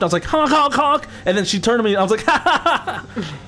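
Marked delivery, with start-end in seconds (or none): 0.2-1.1 high, punchy honks; jeering mock honks; car horn imitation. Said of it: "freaking honked my horn". 2.3-3.3 high, jeering ha-ha-ha; exaggerated taunting cackle; mock laugh. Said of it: "I saw that and I laughed".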